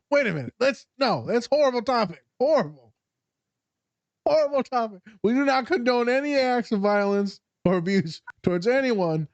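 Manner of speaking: high-pitched mock alarm; mock-official disclaimer pitched well up; hasty disclaimer. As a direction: backpedal in a high, protesting voice.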